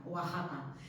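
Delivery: "Oaxaca" as wa-JA-ka; "Oaxaca", its X pronounced as a J. Shown as a demonstration. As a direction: say "Oaxaca" with a throaty Spanish J. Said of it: "se tiene que pronunciar J".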